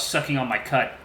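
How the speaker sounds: plain reading voice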